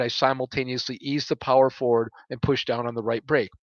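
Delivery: ordinary delivery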